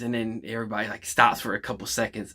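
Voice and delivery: ordinary talk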